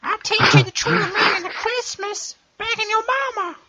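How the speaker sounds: very high cartoon voice; silly high voice. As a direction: squeak it out like a cartoon kid.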